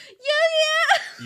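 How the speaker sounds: high, very loud mock-heroic shout; yelled transformation cry. Co-host imitates Yugi becoming his alter ego, yelled high and loud. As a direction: yell it like an anime hero transforming. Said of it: "why I yelled it".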